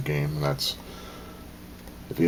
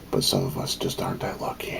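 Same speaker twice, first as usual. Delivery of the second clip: whisper; 10 semitones lower, 4 dB quieter than usual. Real, not acted. Sad reflection on unhappy lives.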